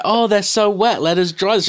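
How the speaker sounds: high, loud mock gushing; gushing girls. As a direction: ham up the fussing girls. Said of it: "they're all like".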